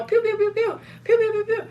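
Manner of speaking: rapid squeaky "pew" gun noises; high-pitched gunfire imitation. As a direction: mimic toy-like shooting in a high voice.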